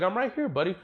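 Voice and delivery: a hint of chipper Goku; mock Goku voice.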